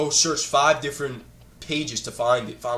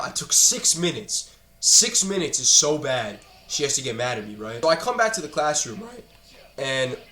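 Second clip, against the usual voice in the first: ordinary talk, nothing marked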